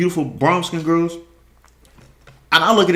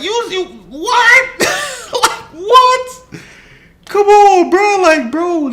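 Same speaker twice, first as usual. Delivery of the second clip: high, laughing disbelief, getting louder; incredulous laughing; 6 dB louder, much slower than usual. Real, not acted. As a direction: squeal with laughter at the absurdity.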